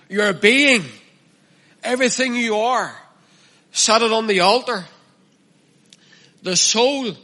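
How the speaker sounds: full-force preaching. Acted. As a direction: preach it hard, push every line up.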